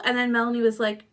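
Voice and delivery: ordinary delivery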